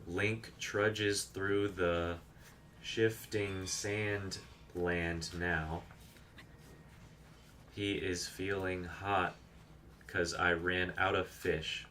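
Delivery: slow, drawn-out mock-poetic recital; improvised sonnet. Streamer to chat, improvising a game sonnet on request, slow poetic recital. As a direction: intone it like a solemn bard, lightly hammed. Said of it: "Do you have a Breath of the Wild sonnet in you?".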